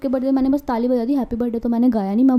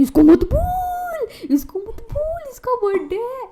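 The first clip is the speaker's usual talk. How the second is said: imitating mom's drawn-out, high-pitched pleading; hyperactive mom; 10 semitones higher, slower than usual. Mom defends the birthday girl, in an imitated hyperactive high tone. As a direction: put on a mother's high, hyperactive scolding tone. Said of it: "ये मेरी mummy की hyper active tone है".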